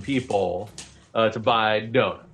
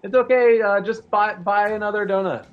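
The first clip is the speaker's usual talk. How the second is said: hedgeling; 8 semitones higher than usual. Acted. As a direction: reassure the customer in a squeaky voice. GM voices hedgeling reassuring player.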